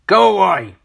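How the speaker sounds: storybook grump shouting; angry dismissal. A narrator's reading. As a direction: send them packing crossly. Mister Grumpy sends neighbour off.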